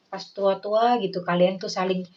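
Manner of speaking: ordinary talk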